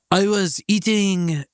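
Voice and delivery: hammed-up French accent. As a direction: imitate a Frenchwoman, drawn out. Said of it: "she had a French accent".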